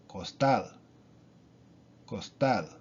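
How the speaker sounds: costal with the stress on tal; last-syllable stress. Shown as a demonstration. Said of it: "Then it is the last syllable".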